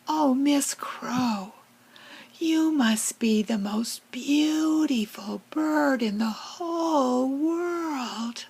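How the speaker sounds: butter up the crow, drawing each word out; flattering sweet talk. Acted. Sweet-talking the crow for cookie.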